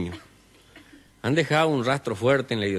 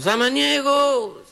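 calling out from afar; 5 dB louder, much slower than usual. Acted. Visitor calls from the gate.

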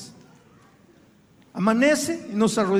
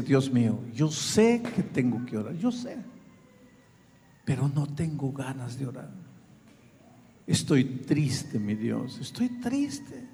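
oración triste; 9 semitones lower, 6 dB quieter than usual. Pretend. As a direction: pour out a sad heart to God softly. María abre el corazón triste. (oración triste y suave, lenta)

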